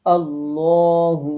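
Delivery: আল্লাহু drawn out slowly, the ল thick and lips rounded; heavy lam, slow. Shown as a demonstration. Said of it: "এরকম ভাবে পড়তে হবে". (ঠোঁট গোল করে মোটা ল-তে আল্লাহু)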